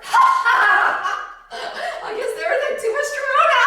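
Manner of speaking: high, loud helpless laughter; cracking up loudly while talking; real laughing. Real, not acted. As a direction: burst out laughing through the words. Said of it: "I'm laughing, but I'm serious".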